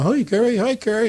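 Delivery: mock-call a greeting across the room; lightly voiced fans calling out; crowd calling out.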